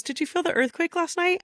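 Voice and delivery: a high, chirpy take on the client; client's question. Retold or imitated. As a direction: lightly mimic the client's surprise. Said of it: "my client goes".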